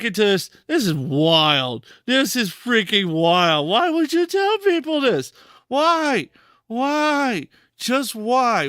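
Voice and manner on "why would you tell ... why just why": high incredulous why; exasperated disbelief. Real, not acted. Disbelief at streamer's story.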